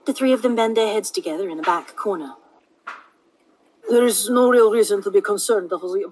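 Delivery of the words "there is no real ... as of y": put-on slightly Indian accent. A narrator's reading. Holson reassures the others, slightly Indian accent. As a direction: speak with a hint of an Indian accent. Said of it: "and slightly Indian".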